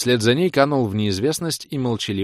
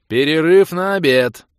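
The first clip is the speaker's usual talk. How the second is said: loud, booming call; booming announcement; 8 semitones higher, 9 dB louder than usual. A narrator's reading. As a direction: announce it loudly to the crowd. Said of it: "громогласно объявил я".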